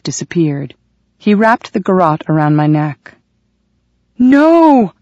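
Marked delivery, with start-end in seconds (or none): 4.2-4.9 desperate shouted no; scream. Acted. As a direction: scream it in terror.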